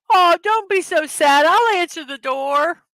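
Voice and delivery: high, playfully comforting; mock consoling. Streamer playfully comforts the boo-hoo joke, mock soothing. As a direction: coo as if soothing a crying child.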